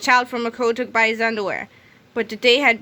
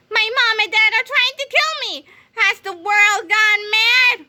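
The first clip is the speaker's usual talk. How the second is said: panicked; 11 semitones higher, 4 dB louder than usual. Acted. Frantic principal in kid body.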